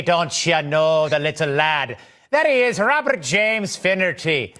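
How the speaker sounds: stage-Irish lilt, slow and jokey; mock Irish accent. Host imagines being introduced with Irish flair, mock Irish accent. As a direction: ham up a light Irish brogue for the intro. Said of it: "As I've said before, I am Irish".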